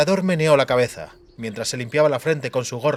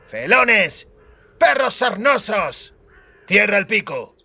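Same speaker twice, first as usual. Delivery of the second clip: furious; 7 semitones higher, slower than usual. A narrator's reading. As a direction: hurl insults, red with fury. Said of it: "enrojeciendo como una cereza". (insultos furiosos, agudos y fuertes)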